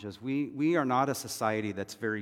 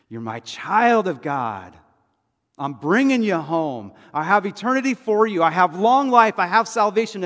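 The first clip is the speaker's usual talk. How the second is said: fatherly assurance rising to an impassioned cry; God; 8 semitones higher, 13 dB louder than usual. Acted. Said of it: "you hear God's voice saying".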